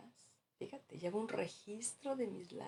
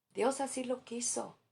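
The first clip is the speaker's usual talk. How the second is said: "Dios así lo quiso" agudo, con tono sentencioso; cliché imitado; 6 semitones higher than usual. Retold or imitated. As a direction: imita con ironía una frase hecha. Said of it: "Se defiende porque no sabe consolar". (frase hecha más aguda, de sermón falso)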